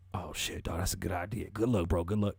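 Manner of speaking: low, subdued mock caller; 911 caller. Acted caller answering the operator.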